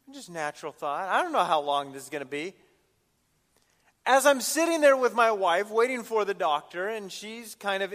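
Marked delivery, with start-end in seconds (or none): none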